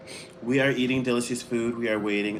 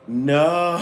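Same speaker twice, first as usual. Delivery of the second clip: laughing no; 3 semitones higher, 3 dB louder than usual. Real, not acted. Reacting to surprise.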